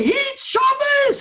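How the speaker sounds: high, slow, shouted "hit Shabbos"; wailing cry. Retold or imitated. Rabbi imitating the rebbe at the wedding, wailing shout. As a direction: stretch "hit Shabbos" into a drawn-out wail. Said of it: "That's the way he said it".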